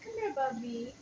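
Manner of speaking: soft high coo. Real, not acted. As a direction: coax the cat gently. Cooing to cat.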